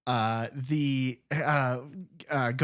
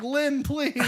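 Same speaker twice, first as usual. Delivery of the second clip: pleading husband; 13 semitones higher, 4 dB louder than usual. Retold or imitated. Husband pleading, voiced high.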